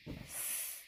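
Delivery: स as a bare unvoiced hiss, no vowel; just hiss the स; hissed s sound. Shown as a demonstration. Teacher showing the pure स sound for children, a bare hiss. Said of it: "एस का sound होता है स".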